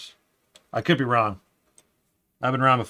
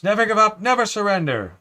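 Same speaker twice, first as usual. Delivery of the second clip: high, loud hammed-up catchphrase; heroic film quote; 10 semitones higher, 7 dB louder than usual. Streamer quotes Galaxy Quest back to Dennis, loud mock-heroic. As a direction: proclaim it like a mock rallying cry.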